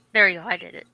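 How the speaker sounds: normal talking voice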